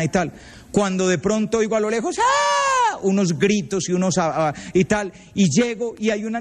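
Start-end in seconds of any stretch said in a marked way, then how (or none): 2.2-3.0 high shrill girlish shriek imitated; imitating a girl's scream at a bat; mock scream. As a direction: squeal like a scared girl. Girls screaming over a bat.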